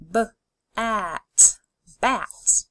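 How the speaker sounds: d, ot, dot, parts pulled apart then joined; D, ot, dot: each part separate, then the whole word; segmented then blended. Shown as a demonstration. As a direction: sound it out and blend it. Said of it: "Say the sounds with me and blend together".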